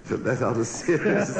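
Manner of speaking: suppressed giggle through "let out a series"; fighting back laughter, slowed; laughing. Real, not acted. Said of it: "doesn't seem to help".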